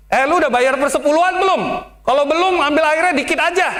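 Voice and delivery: high, fast, blunt Betawi jab; rough Betawi talk. Retold or imitated. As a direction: bark it like a rough Betawi elder. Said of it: "kok gitu ngomongnya". (gaya Betawi kasar, cepat dan tinggi)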